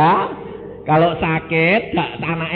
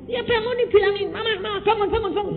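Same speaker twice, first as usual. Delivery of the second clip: high, insistent call of Mama, bangun; calling to wake; faster than usual. Retold or imitated. God shows the son how to call his mother awake, high.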